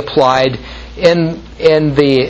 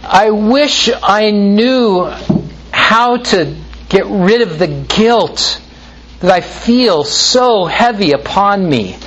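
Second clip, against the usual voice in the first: sighing moaner; 5 semitones higher than usual. Retold or imitated. Preacher mimics people who sigh about life's meaning, moaning. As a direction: moan it like a self-pitying seeker. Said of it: "They sigh and they moan".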